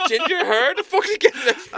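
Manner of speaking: high loud laughing outburst; laughing disbelief. Real, not acted. Recalling the description he was given, laughing loudly. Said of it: "laughing disbelief".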